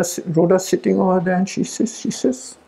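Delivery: ordinary talk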